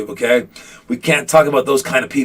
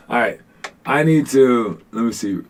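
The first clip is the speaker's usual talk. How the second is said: ordinary delivery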